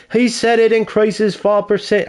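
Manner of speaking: high-pitched attempt at a British accent; bad English accent. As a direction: imitate him in a badly faked English accent. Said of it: "I can't do an English accent".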